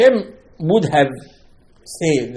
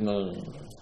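soft dismissive no; 6 semitones lower, 10 dB quieter, faster than usual. Retold or imitated. Rabbi dismissing with soft repeated no.

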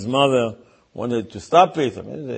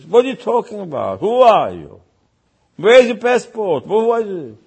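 loud, higher mock interrogation; raised-voice demand for answers; indignant challenge; 6 semitones higher, 6 dB louder than usual. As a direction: confront a suspicious stranger in acted outrage. Father challenges the stranger.

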